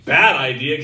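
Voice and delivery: ordinary talk, nothing marked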